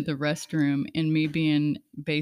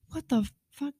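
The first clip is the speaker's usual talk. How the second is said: shocked inner thought; 10 semitones higher than usual. Retold or imitated. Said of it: "I'm, like, thinking in my mind".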